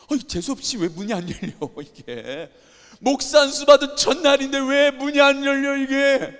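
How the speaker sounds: high, loud furious yell at the door; angry outburst. Retold or imitated. New pastor yelling at the locked door, furious. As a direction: rage at the stuck door. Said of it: "너무 화가 나는 겁니다".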